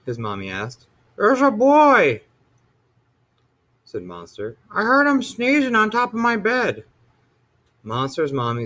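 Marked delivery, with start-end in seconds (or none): 1.2-6.8 a little monster telling his mother; frightened child. A narrator's reading. Little monster reports a boy.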